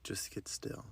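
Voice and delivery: breathy hush with no voice; whisper. Acted. Host hushing listeners into stillness, whispered. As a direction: whisper it to settle them.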